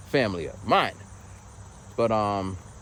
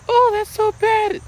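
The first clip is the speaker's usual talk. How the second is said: slow, much higher, louder mock-sympathetic coo; fake sympathy; 7 dB louder, slower than usual. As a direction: overdo the therapist's fake concern. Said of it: "Like the fake sympathy shit".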